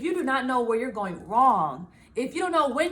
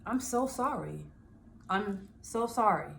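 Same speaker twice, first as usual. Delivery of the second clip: soft apology; 4 semitones lower, 6 dB quieter than usual. Retold or imitated. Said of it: "now, the new me".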